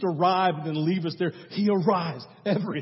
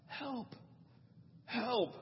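faint cry for help; 6 semitones higher, 12 dB quieter than usual. Acted. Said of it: "he heard a faint cry".

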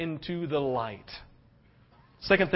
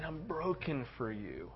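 very soft, slow, lower confession; hushed compassion; 3 semitones lower, 15 dB quieter, slower than usual. Retold or imitated. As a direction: voice Paul's heartbreak almost under the breath. Voices Paul's compassion for people.

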